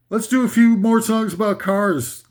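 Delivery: normal talking voice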